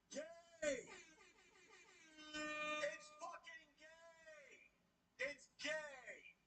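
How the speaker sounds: drawn-out high yell of disgust; exasperated yell. Real, not acted. Fed-up streamer to chat, stretched high exasperated yell. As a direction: yell it, stretched, sick of it all. Venting rant peak.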